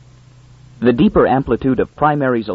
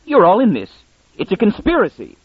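sudden conviction; 9 semitones higher than usual. A narrator's reading. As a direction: blurt it out in feverish certainty. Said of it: "with sudden conviction".

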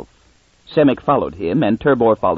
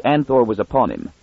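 ordinary delivery

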